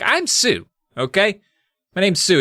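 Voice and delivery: put-on high, insistent girl correcting him; indignant correction. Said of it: "corrects him. She's like".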